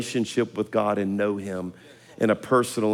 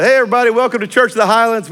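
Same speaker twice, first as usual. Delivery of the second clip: fast, much higher, louder announcer greeting; pulpit welcome; 9 semitones higher, 6 dB louder, faster than usual. Retold or imitated. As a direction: greet the whole congregation with energy. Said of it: "so Sunday I preached".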